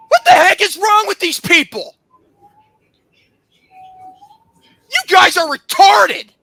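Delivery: high, loud disbelief; real indignant yelling; outraged shouting. Real, not acted. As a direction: burst out in furious incredulity. Outraged reaction to the message.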